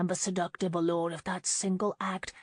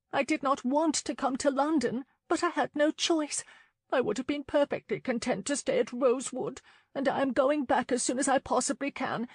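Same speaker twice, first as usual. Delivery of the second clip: put-on higher, a proud young lady insisting; young woman voice; 7 semitones higher than usual. A narrator's reading. Lauren insists to Alex she will return to Rosewood, higher and firm. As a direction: voice her firm, wanting to go home.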